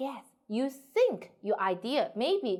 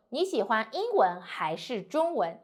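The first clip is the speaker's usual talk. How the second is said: 英文 rises, 中文 falls at the end; rising then falling choice. Shown as a demonstration. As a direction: lift the first, let the second drop. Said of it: "no matter which one in the first, we just go up".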